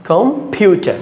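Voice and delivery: "Computer" with পিউ lifted high; second-syllable stress. Shown as a demonstration. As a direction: punch পিউ in "computer", then drop on টার. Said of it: "দ্বিতীয় সিলেবলতে কি দিতে হবে".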